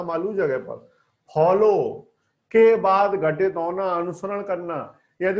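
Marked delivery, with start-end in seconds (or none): none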